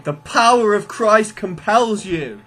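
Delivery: hammed-up priest casting out a demon; mock exorcist. Joking about fighting with Bibles, mock exorcist chant. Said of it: "You mean, like".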